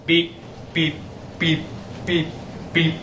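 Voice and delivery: beeping alarm imitation. Shown as a demonstration. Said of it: "an alarm that sounds like this".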